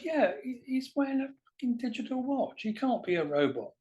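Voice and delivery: high, squeaky lad insisting; young man arguing, voice pushed up high; mocking young guy. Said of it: "He goes".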